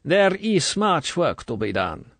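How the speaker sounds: slow, high, firm and official; authoritative. A narrator's reading. Colonel setting out the work, slow authority. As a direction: lay down the task. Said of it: "Authority was back in the voice".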